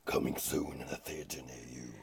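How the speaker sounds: trailer voice. Pretend. Mock movie trailer.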